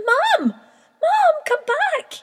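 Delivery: high, loud desperate call. A narrator's reading. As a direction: shout after her into the sky. Chick calls after flying mother.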